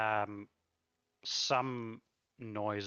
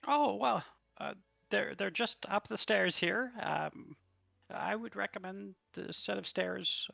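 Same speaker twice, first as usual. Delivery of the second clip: waitress voice; 11 semitones higher than usual. Acted.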